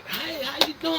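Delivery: mock fake friendliness. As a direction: lay on the phony politeness. Mocking fake friendly greeting.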